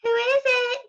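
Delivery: put-on high old lady voice; old granny voice. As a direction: call out like a frail old granny. Granny calls through door.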